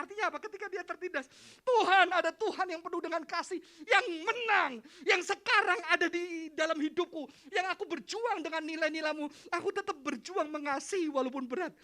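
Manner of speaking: high, shouted sermon; full-force preaching. Acted. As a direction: cry out the faith with great force.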